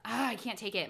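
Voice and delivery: usual speaking voice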